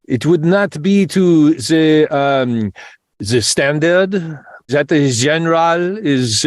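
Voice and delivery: ordinary delivery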